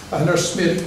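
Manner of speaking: normal talking voice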